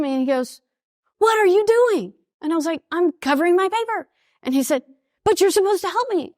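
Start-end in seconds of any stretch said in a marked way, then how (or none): 1.2-2.1 high-pitched imitation of an affronted husband; mock indignant. Retold exam quarrel with husband. 2.9-4.1 high-pitched retort, re-enacted; mock defensive. Retold exam quarrel with husband. 5.2-6.3 high, pleading imitation of a husband; mock protest. Retold exam quarrel with husband.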